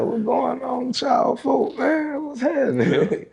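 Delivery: very high, slow falsetto greeting; hammed-up squeaky hello to cover the shock; mock cheerful greeting. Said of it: "I was like".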